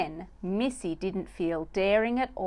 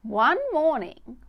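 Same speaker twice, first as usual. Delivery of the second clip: plain reading voice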